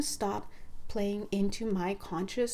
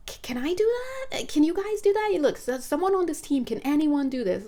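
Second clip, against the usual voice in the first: incredulous pleading; 6 semitones higher than usual. Retold or imitated. Acting out doubting questions to guides.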